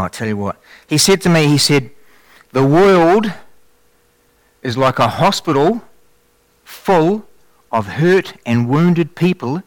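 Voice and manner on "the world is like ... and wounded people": slow, weighty words, each one placed; slow solemn quote. Retold or imitated. Preacher quoting what the Lord told him, slow and weighty. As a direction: deliver a divine message slowly. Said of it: "Listen very, very carefully".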